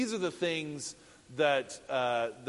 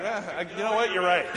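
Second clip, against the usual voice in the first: louder, higher sheepish giving in; sheepish concession; 4 semitones higher, 7 dB louder than usual. Retold or imitated. Son-in-law conceding to Walt, sheepish and louder. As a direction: cave in, a little embarrassed. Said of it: "And I'm like".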